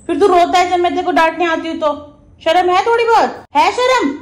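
high, loud shaming scold; mock-angry telling off of the pet. Real, not acted. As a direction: shame the pet into behaving.

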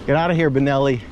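ordinary talk

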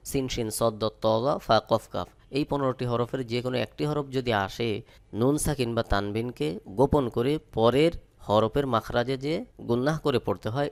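usual speaking voice

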